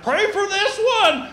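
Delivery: high, slow put-on voice of a grown man asking; playfully imitating a grown man asking for prayer; mock voice of a grown man. Preacher mimics a grown man asking prayer, high and slow.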